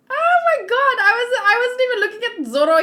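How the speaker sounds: high, loud, fast burst of amazement; excited disbelief. Real, not acted. Seeing Zoro's new look, loud fast excited shock. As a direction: blurt it out, stunned and thrilled.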